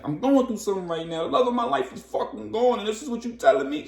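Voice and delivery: high, loud anguished yell; breaking down in anger. Retold or imitated. Storm breaks down yelling at Tony, high and loud. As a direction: scream out the grief. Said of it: "She's breaking down".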